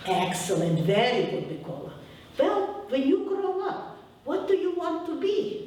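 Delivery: put-on sweetly asking teacher, Ukrainian accent, rising high. Teacher asking little Mikola his future, high Ukrainian-accented question. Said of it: "I'll say it with my accent".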